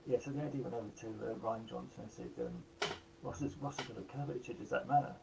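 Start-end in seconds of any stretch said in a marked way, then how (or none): none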